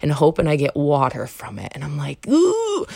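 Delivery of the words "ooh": squeaky high ooh of dismay; mock high ooh. Reacting to a silent date, high startled ooh. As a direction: let out a high awkward ooh.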